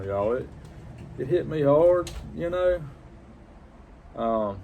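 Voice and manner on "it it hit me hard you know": choked up, holding back tears; fighting not to cry on the words; voice breaking. Real, not acted. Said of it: "I'm gonna try not to get emotional".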